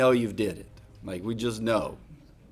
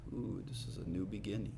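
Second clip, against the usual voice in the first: 1 hushed wonder; 6 semitones lower, 15 dB quieter, faster than usual. Pretend. Imagined listener reaction.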